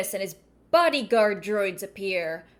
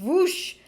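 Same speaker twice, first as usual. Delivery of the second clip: higher, rising whoosh like a saber swing; lightsaber whoosh; 7 semitones higher than usual. Shown as a demonstration. She repeats the saber sound for fun, a higher whoosh. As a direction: zip the blade through the air. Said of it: "that does get a good lightsaber sound".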